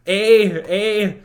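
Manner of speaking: hammed-up Canadian interjection; Canadian accent. Shown as a demonstration. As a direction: punch out a joke Canadian "eh". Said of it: "Give us that Canadian accent".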